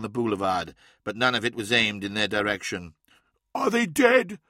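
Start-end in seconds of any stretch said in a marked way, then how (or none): 3.5-4.4 high-pitched character voice for Lemuel; high, urgent question from the frightened neophyte; anxious pupil. Acted. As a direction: ask it high, a startled question.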